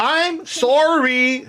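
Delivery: high, drawn-out creature voice; apologetic Kenku. Acted. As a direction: stretch out a high, sorry squawk. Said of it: "says the Kenku".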